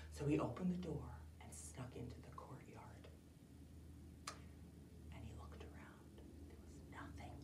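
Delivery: whispered narration; storyteller whispering the sneaky part; suspenseful whisper. A narrator's reading. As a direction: hush it down as he sneaks in. Sneaking into the castle.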